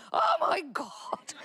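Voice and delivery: high, strained cry of panic from the husband; panicked husband. Retold or imitated. Wife imitates husband panicking at sparks. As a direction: squeal it like a man who sees sparks. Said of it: "He's going".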